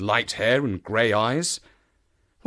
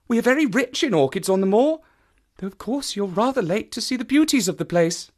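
high, light ladylike voice; pitched-up woman, brightly covering up; Stapleton's sister, making polite small talk; 10 semitones higher, 4 dB louder than usual. A narrator's reading. Woman chats about orchids as cover, politely.

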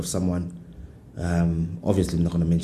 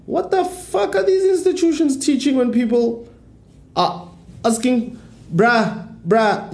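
high, squeaky exasperated rant; slower than usual. Retold or imitated. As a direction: go way up in disbelief. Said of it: "I'm like".

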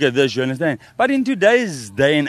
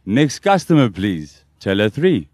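low flat cashier call; bored mechanical politeness; 6 semitones lower, slower than usual. Shown as a demonstration. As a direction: drop it low like a store voiceover. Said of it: "and then say the line".

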